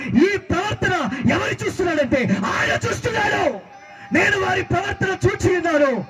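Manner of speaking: high, ringing pulpit cry; full-force preaching. Real, not acted. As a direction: cry out God's words high and fervent. (ఆవేశంగా ఎత్తైన స్వరంతో ప్రసంగం)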